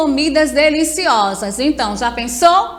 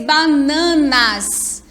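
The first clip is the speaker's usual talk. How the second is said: "bananas" drawn out slowly as ba-na-nas; syllable by syllable; much slower than usual. Shown as a demonstration. Teacher counting syllables for pupils, "bananas" said slowly in pieces. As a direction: stretch each syllable of "bananas". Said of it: "e três sílabas".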